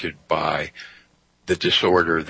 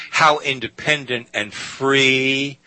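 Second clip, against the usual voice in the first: "free" stretched out slow and pitched up high; emphasis on free; 6 semitones higher, slower than usual. Real, not acted. Telling radio listeners what's at stake, stress on "free". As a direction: lean hard on "free" as the key word. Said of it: "And I emphasize the word free".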